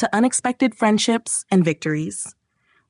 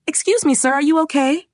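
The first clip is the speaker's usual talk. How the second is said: higher-pitched questioning character voice; Pockets; 7 semitones higher than usual. A narrator's reading. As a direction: ask it in a raised, higher voice. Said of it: "Pockets asked".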